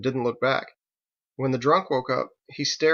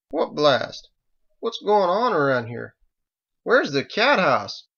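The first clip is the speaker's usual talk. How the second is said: put-on higher, louder bewildered drunk; dazed, searching, slow at the end; confused drunk voice; 4 semitones higher, 4 dB louder, slower than usual. Bewildered drunk wakes to chaos.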